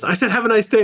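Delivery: mock panicked worker pleading. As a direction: imitate him losing it, pitch flying up. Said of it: "he starts freaking out and goes".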